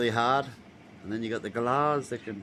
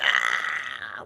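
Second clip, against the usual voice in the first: retold yelp; 8 semitones lower, 7 dB louder than usual. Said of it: "like that".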